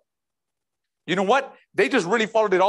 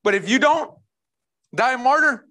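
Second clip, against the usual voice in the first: normal talking voice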